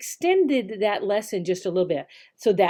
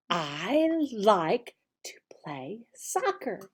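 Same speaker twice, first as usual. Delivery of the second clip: whispered, much softer; 7 dB quieter than usual. Shown as a demonstration. Teacher whispering the answer for a child. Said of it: "The, the page actually says".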